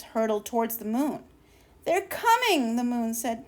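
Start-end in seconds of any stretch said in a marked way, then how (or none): 1.8-2.8 much higher, eager; thrilled at what she sees; excited. Acted. Moon watching the spaceships approach, high and excited.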